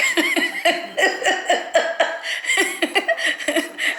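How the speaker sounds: high burst of laughter. Real, not acted. Prank revealed, laughing.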